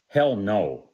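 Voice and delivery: loud, blunt "Hell no"; wife's flat refusal. Retold or imitated. Husband retelling wife refusing toolbox island, loud refusal. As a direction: shut it down hard. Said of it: "She said".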